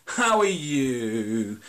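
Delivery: slow, drawn-out "How are you?"; mock sing-song greeting. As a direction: stretch out a fake caring hello. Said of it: "they're kind of doing this".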